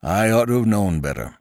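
a hint of a rueful old man; lightly voiced landlord. A narrator's reading. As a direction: admit the mistake gruffly. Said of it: "he said".

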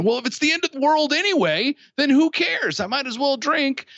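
high, quick, careless voicing of a tempted drinker; voicing the anxious person's excuse to drink, high and flippant; imagined reckless thought. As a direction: toss it off like someone who's given up caring. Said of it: "some of the thoughts that are going through people's minds".

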